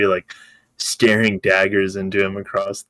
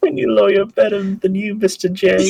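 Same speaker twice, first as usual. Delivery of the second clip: high, hammed-up New York accent; exaggerated New York accent; 12 semitones higher, 4 dB louder than usual. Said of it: "New York accent".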